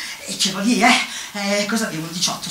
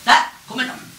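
ordinary talk, nothing marked

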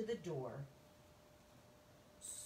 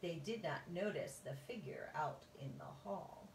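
ordinary delivery, nothing marked

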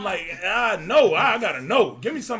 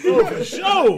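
laughing hard; 8 semitones higher, 14 dB louder than usual. Real, not acted. Cracking up at her answer.

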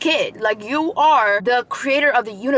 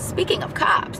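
higher, breathy whispered aside; stage whisper; 6 semitones higher, 3 dB quieter, slower than usual. Shown as a demonstration. To her viewers about the cops nearby, stage whisper. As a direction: hush it like a secret warning. Repeats phrase to mark cops.

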